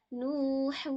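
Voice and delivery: نوح with a sustained "oo"; drawn-out vowel. Shown as a demonstration. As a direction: hold the long "oo" in نوح. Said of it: "ہم کھینچ رہے ہیں".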